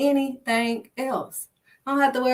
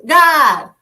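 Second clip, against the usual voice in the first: loud, ringing cry of God; exclaimed God; 4 semitones higher, 11 dB louder than usual. Real, not acted. Preacher crying out to God, loud and ringing. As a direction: throw the name out with full force. Exclaiming God's name mid-sermon.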